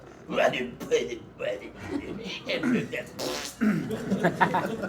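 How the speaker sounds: wordless cartoon-beast grumbling and sputtering; Taz grumbling. Voice actor showing how Taz grumbles on a bad day, gibberish grumble. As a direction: grumble through garbled noises, annoyed but not raging. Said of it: "he has to grumble".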